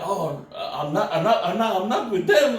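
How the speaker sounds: nervous protest rising in pitch; panicked denial. Retold or imitated. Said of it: "I got scared".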